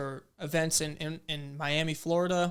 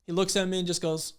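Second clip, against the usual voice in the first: ordinary talk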